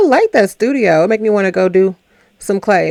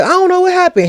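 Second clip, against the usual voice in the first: mock confused Fritz, high; 11 semitones higher, 3 dB louder, faster than usual. Retelling Fritz's confused line.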